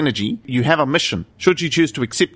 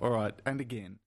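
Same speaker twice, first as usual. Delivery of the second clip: normal talking voice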